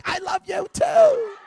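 high, loud, drawn-out call; hammed-up yell across an airport; yelling back. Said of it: "I'm yelling back".

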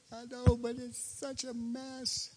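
high, slow, whining voice; a hand-wringing worrier; slow, high and fretful, whining to a friend; whiny fretting self. Retold or imitated. His fretting self answering Cindy.

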